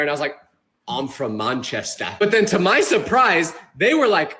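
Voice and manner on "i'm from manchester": low, soft, slow fake Manchester accent; hesitant put-on British, pitched down; pretend British accent. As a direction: bluff a British accent, drawn out. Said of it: "I kept pretending to be British".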